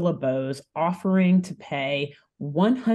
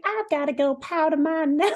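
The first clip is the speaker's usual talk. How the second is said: Scarlett's voice; 10 semitones higher than usual. Acted. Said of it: "Scarlett's like".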